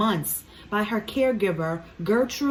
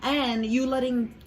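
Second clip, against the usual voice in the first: ordinary talk, nothing marked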